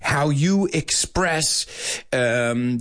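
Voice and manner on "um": ordinary talk, nothing marked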